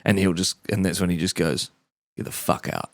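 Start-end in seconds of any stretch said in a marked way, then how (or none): 2.2-2.9 hushed, unvoiced mock menace; acted low-volume threat; quiet dismissal. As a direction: deliver the rejection under your breath. Acting the player's rejection.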